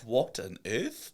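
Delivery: slow, drawn-out baffled question. Retold or imitated. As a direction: stretch it out in disbelief. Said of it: "I'm like".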